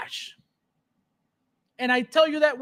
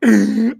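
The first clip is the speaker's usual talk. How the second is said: a loud laugh bursting out; real laughter at chat; 8 dB louder than usual. Real, not acted. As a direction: crack up loudly. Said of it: "I'm crying".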